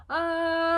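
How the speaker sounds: squeezed high note going sharp; strained sharp note. Shown as a demonstration. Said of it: "that makes you go sharp".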